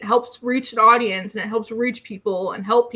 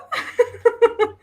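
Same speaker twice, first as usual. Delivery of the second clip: high, giddy giggle; giggle, overwhelmed with delight; giddy laugh; 11 semitones higher than usual. Real, not acted.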